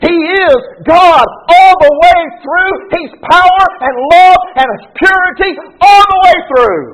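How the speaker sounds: preacher lifting the congregation; rising preaching fervor. Real, not acted. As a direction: build to the sermon's peak. Preaching climax on God's holiness.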